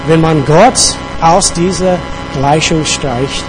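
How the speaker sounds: usual speaking voice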